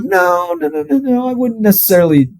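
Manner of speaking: high, fast flustered backpedal as the parents. Retold or imitated. Parents hastily denying they called him fat, high and flustered. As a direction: play the parents squeaking out a nervous denial.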